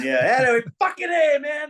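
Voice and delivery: high excited cheer from the stands. Retold or imitated. Dad cheering his son from the crowd, high excited cheer. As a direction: whoop for your son. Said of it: "in the crowd going".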